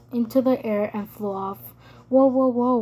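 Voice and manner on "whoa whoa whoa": loud, quick calming interjection; quick alarm. A narrator's reading. Ravi grabbing her hand from the window, quick and loud. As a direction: cut in fast to stop her. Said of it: "Ravi said quickly".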